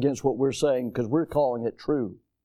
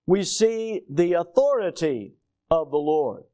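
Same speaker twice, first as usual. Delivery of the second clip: normal talking voice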